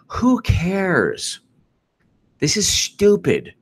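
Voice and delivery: high, incredulous scoff; exasperated. Real, not acted. Dismissing the Roger Stone story, high and incredulous. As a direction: toss it off in disbelief.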